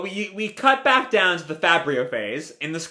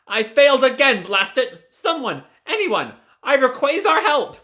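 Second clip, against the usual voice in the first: high, hammed-up frustrated plea; calling for help; 8 semitones higher, 3 dB louder than usual. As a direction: call out to anyone for rescue. Stuck scientist calls for anyone.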